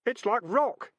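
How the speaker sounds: a hint of heaving effort; straining boy. A narrator's reading. Keith straining.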